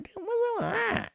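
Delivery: very high squeaky aside; mock baffled squeal. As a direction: squeal it way up in falsetto. Acts out own surprised reaction.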